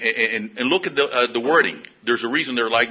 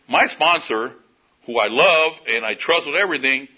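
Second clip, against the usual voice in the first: normal talking voice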